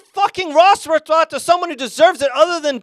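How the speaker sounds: loud, high heated rant. Real, not acted. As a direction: vent about the picks.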